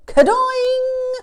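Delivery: very high, loud boing; springy sound effect. A narrator's reading. Storyteller as the nose breaks free, loud boing. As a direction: twang it out like a spring.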